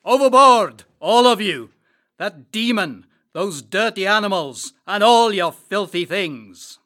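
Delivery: the captain ordering everyone overboard, shouting. Acted. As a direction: roar them off the ship. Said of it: "the captain shouted at us".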